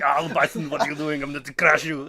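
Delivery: loud mock Schwarzenegger accent; Arnie impression. Mocking a miscast Arnie to a friend, loud Schwarzenegger accent. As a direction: bark it like Arnold Schwarzenegger. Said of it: "it just like".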